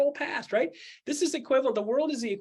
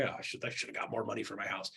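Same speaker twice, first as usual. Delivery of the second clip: mock regretful seller; 8 semitones lower, 9 dB quieter than usual. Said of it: "frustrated, angry".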